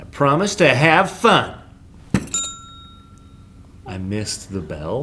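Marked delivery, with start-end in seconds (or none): 0.0-1.6 loud, higher, mock-solemn pledge with gusto; gleeful oath. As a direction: belt out the pledge with glee.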